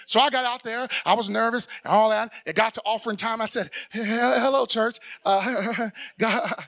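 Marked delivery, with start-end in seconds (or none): none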